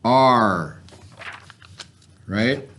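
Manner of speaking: ordinary talk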